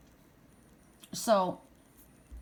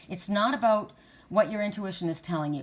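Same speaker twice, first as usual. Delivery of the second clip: usual speaking voice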